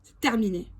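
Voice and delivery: ordinary talk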